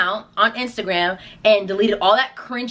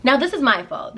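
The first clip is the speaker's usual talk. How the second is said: ordinary talk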